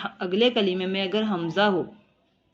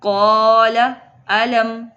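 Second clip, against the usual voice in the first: slow, drawn-out Quranic chant with held vowels; Quran recitation; 7 dB louder, much slower than usual. Shown as a demonstration. Teacher reciting the verse for students, slow tajweed chant. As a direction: recite it slowly like tilawat. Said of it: "teacher recites verse in tajweed".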